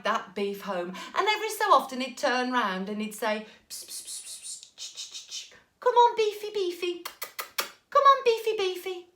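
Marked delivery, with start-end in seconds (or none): 3.7-5.5 soft unvoiced psst-psst cat call; whispery pet-calling noises; coaxing noises. Jack coaxes beef like cat. 5.8-9.1 high sing-song pet call; squeaky, cajoling falsetto; pet-coaxing. Acted. Jack coaxes beef like pet.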